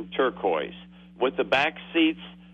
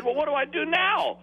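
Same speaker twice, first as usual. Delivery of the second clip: much higher, plaintive question; helpless teen; 13 semitones higher than usual. Retold or imitated. Teen asking the examiner what now, high and plaintive. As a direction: sound lost and helpless.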